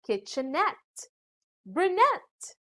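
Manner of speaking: "kitchenette, brunette" landing on ETTE and NETTE; last-syllable stress. Shown as a demonstration. Teacher giving examples of ending et, "kitchenette, brunette" stressed at the end. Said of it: "usually carry stress on the last syllable".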